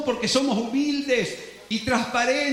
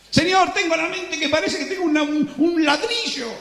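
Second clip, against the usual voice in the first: higher, halting anxious plea; confused believer; 6 semitones higher than usual. Acted. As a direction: stammer out helpless confusion. Believer pleads to God.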